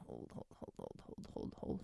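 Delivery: strained whisper, coaching a held squeeze; whispered hold cues. Shown as a demonstration. Coach whispering hold to listeners during tensing exercise. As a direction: breathe the holds out like a tense coach. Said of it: "We're gonna tighten up".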